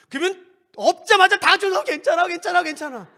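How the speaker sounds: high, loud rush of comfort; eager comforting. Acted. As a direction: pile on the reassurance all at once. Acting out comforters rushing in.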